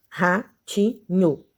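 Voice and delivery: "Ratinho" in three slow beats, ra-ti-nho; syllable by syllable. Shown as a demonstration. Teacher counting syllables aloud, "Ratinho" slowly broken up. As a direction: pause between the syllables of "Ratinho". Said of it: "três".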